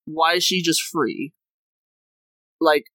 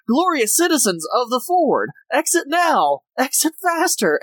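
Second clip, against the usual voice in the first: higher-pitched parody of a pompous evacuation order; mock grand announcement; 7 semitones higher than usual. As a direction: proclaim it like a self-important leader, mockingly. Mocks a crew member's grand evacuation order.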